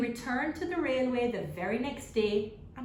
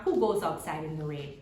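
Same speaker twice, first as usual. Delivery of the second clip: plain reading voice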